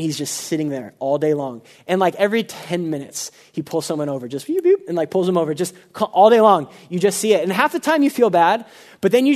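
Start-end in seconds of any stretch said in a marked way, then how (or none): 4.5-4.9 mock siren beeps. Imitating a police siren.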